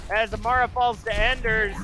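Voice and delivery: excited esports caster calling a fight; rapid-fire match commentary easing down; live play-by-play. Real, not acted. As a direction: burst in high on the big play, then settle. Calls a sudden big play.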